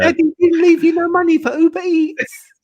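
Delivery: very high taunting coo; high, patronising singsong voice; mock baby talk. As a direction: tease a little child in falsetto. Mocking a commenter as a child.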